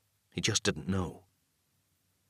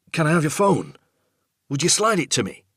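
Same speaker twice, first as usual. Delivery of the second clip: higher, louder question into the dark; calling out; 8 semitones higher, 7 dB louder than usual. Acted. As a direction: call out to the unseen father. Son calling into darkness.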